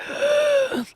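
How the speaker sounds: high, horrified mock gasp; sharp gasp, hammed up; dramatic gasp. Wife recalling rides with her husband, mock-horrified gasp. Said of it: "really just being like".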